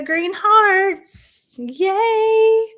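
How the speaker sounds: high, slow, loud cheering at the hearts; excited cheer. Real, not acted. Streamer cheering viewers' green hearts, high and thrilled. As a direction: squeal with delight over the hearts.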